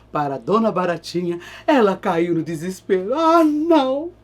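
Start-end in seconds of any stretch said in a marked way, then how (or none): 3.1-4.1 high, loud theatrical lament; hammed-up sobbing despair; mock wailing grief. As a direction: wail over the lost groom. Baratinha hears of death.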